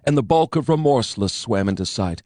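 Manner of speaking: ordinary delivery, nothing marked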